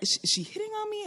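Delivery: quick, high, hushed bewilderment; confused. Retold or imitated. Woman privately wondering about a stranger's approach, confused. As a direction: rush it softly, high-pitched and puzzled. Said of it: "I was just so confused".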